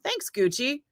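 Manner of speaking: high, sarcastic put-on shopper voice; mock sarcastic shopper. Shopper mocking a fancy store clerk, sarcastic high voice. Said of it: "and you're like".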